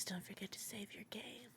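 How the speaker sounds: hushed unvoiced whisper. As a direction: whisper the advice. Reading Monica's tip.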